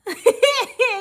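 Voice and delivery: high, loud burst of laughter. Real, not acted. Amused by chat's Indian accent.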